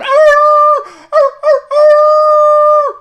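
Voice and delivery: very high, loud mock dog howl. Fan imitating dogs at home howling, high and loud. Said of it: "dogs at home are screaming".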